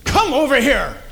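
loud, sharp summons; harsh order. Shown as a demonstration. Showing how tone changes a call to come, harsh. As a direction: order them over with force. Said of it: "but how we say it".

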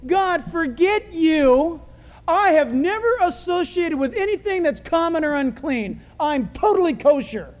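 high, emphatic indignant boast; mock self-righteous. Imitating Peter telling God what he's saying, self-righteous. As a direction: proclaim it with proud indignation. Paraphrases Peter's proud refusal.

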